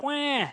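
high mock wah; pouting baby cry sound; childish whining wah; mock whine. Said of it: "they're like".

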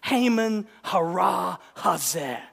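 "Haman hara hazeh" slow, each syllable punched; sharp rhythmic Hebrew. Shown as a demonstration. Preacher shows Esther's accusation, sharp and rhythmic. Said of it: "produces a sharp rhythmic effect in Hebrew".

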